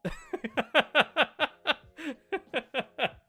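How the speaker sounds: high, loud rapid cackle; long burst of staccato giggling; laugh. Real, not acted. Laughing at the sword jab.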